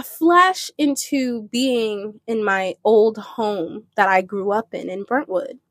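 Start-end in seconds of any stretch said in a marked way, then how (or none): none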